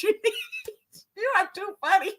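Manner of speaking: laughing through the words, breaking up. Real, not acted. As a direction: talk while still giggling. Still laughing at the joke.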